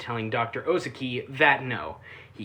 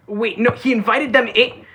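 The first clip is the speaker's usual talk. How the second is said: higher, louder incredulous question; disbelief; 8 semitones higher, 7 dB louder than usual. Real, not acted. Reviewer reacting to Natsuno letting vampires in, incredulous. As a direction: catch yourself in shock.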